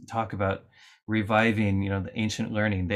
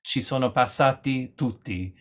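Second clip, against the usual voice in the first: Italian saying; 3 semitones higher than usual. Retold or imitated. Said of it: "some people say of certain parts of Italy".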